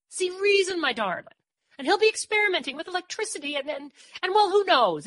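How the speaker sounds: high, agitated appeal; worried pleading. A narrator's reading. Mary warns of the danger to Dor, agitated. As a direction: plead urgently, tumbling into worry.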